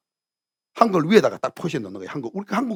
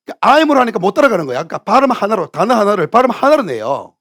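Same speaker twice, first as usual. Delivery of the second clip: ordinary talk, nothing marked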